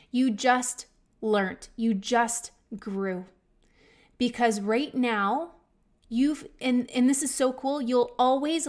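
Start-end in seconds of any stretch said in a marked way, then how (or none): 0.1-3.2 slow, emphatic. Retold or imitated. Repeats slower, to mirror.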